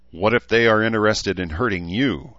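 ordinary delivery, nothing marked

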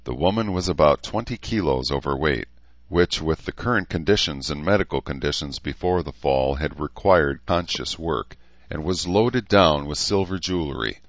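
ordinary delivery

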